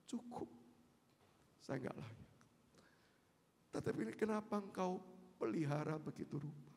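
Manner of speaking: humble prayer. Acted.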